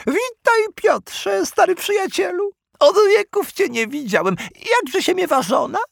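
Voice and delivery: very high, cheerful creature voice; slimy dog-creature voice. Acted. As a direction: greet an old friend, squeaky. Kuropies greets an old friend.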